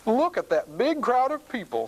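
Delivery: high, curious storybook exclamation; farmer. A narrator's reading. Farmer driving past a crowd, curious. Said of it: "says the farmer".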